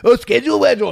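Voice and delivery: high, loud silly echo of the word; mock goofy echo to crack up mom; goofy repetition. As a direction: ham it up for a laugh. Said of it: "and I'd be like".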